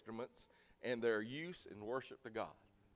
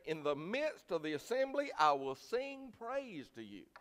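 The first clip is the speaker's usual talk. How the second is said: scripture reading; 7 semitones higher, 5 dB louder than usual. Acted. Pastor reading Ephesians to the church: raised solemn scripture reading. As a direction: read it out as holy writ.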